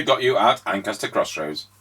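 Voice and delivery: usual speaking voice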